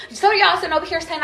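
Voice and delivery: normal talking voice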